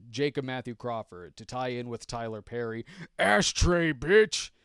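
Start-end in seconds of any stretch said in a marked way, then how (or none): 3.2-4.5 high, loud mock outburst; hammed-up shouted catchphrase; shouted movie quote.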